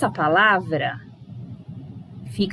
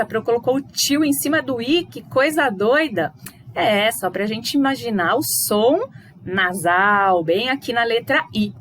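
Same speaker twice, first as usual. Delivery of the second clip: normal talking voice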